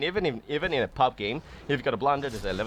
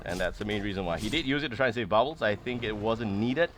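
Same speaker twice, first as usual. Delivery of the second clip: ordinary delivery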